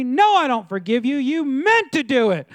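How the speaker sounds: high, loud mock outrage; acted indignant shouting, stretching the accusation; angry refusal. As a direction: throw the apology back, hammed-up and loud. Churchgoer angrily refuses the apology.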